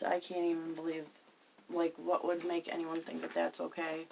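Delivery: ordinary talk, nothing marked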